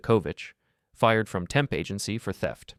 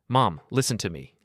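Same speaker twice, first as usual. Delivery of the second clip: usual speaking voice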